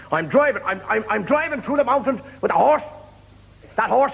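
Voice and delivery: high-pitched aggrieved buyer; indignant complaint. Acted. Cheated buyer confronts horse seller, acted indignation.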